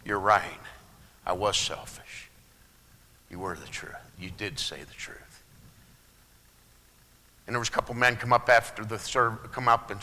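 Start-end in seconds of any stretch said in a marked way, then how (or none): 0.0-5.2 much lower and softer, humbled; a humble, hushed apology; meek reply. Retold or imitated. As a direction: answer softly like a chastened man. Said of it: "I said".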